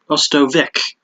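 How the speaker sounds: "Ostovic" said slowly, ending in a hard k; announcer's mispronunciation. Retold or imitated. Quoting the announcer's botched name, slow "Ostovic" with a hard k ending. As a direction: say "Ostovic" slowly with a final "vick" instead of "vitch". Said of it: "he also pronounced Rachel Ostovich's name as".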